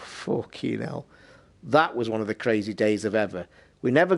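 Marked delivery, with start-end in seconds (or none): 0.0-1.1 exasperated muttered thought. Retold or imitated. Thinking aloud after cat ordeal.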